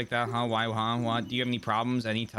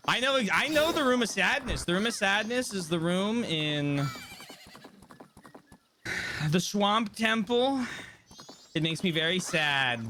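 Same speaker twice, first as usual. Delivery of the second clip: high character voice; 9 semitones higher than usual. Acted. Streamer voicing a game character up high. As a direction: voice the sad temple creature up high.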